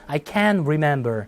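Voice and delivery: "can" drawn out strongly, standing for can't with a silent t; long stressed CAN'T. Shown as a demonstration. Teacher repeating for students to copy, "can" long and stressed. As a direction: hold "can" long, no t burst. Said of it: "이거 길어진다고 했어요".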